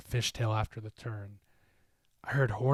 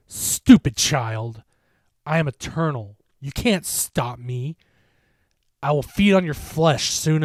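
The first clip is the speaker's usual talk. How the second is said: menacing creature; 3 semitones higher, 10 dB louder than usual. A narrator's reading. The creature scolds Mark, loud menacing threat. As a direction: lecture him like prey.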